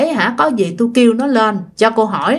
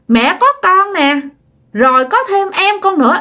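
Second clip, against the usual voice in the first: put-on higher childlike answer; child voice; 7 semitones higher than usual. Ghost child telling mother about its twin, child voice. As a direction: answer like a little kid. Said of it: "cái giọng con nít".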